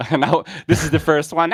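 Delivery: ordinary talk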